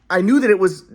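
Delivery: ordinary talk, nothing marked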